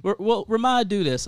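ordinary delivery

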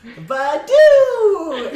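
high, loud, drawn-out Pokemon call; creature cry. Acted. Pokemon popping out to face water foes, high comic cry. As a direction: cry out like a silly little monster.

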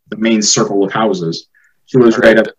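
usual speaking voice

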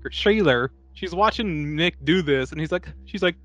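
ordinary delivery

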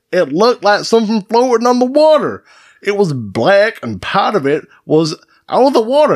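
high-pitched put-on eyewitness voice; Edward J. Ward. Host plays a fisherman reporting a monster sighting, high hammed-up voice. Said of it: "an interview from Edward J. Ward".

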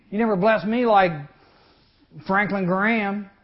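3 demand it like you're owed; 4 believer whining at God for blessings. Retold or imitated. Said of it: "But if we say".

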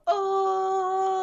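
long, high "oh"; scandalized reaction. Retold or imitated. Co-host recalling her reaction to the comeback, drawn-out high "oh". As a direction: draw it out high, reacting to a burn. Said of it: "Yeah, I went".

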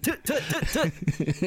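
high, quick tutting clicks; game jump sound. Retold or imitated. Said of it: "that's how it is with Sheik".

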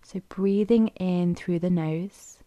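unhurried, even delivery, leaving room to breathe; guided meditation. Acted. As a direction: guide them gently into stillness, phrase by phrase.

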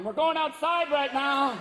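bossy rider. Acted. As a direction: drive him out the door. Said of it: "midget jump on your back going".

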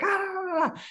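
high-pitched mock yelling noise; imitated yelling. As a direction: mimic an angry woman's wordless high yell. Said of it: "she is yelling and she's like".

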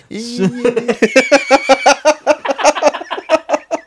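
long, high-pitched laughing fit; helpless laughter. Real, not acted. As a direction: crack up, high and loud. Cracking up at a joke.

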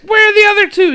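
high and loud confused bellow; shouting old priest. Retold or imitated. Father Jack waking sober, demanding the others loudly. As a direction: bark it out like a cranky old priest.

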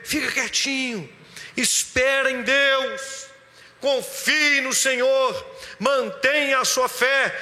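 emphatic sermon climax, words stretched; impassioned preaching. Real, not acted. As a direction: urge the congregation on with rising conviction. Preaches exhortation at full force.

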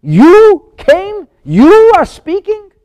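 very loud, high, stretched "you"; upset, incredulous. Acted. Pharaoh upset that Moses is the speaker, loud and incredulous. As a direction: hurl each question in scornful disbelief. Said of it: "He's upset that you".

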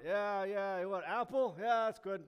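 hoger en luid, onverschillig; lazy Adam. Retold or imitated. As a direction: knik alles achteloos weg.

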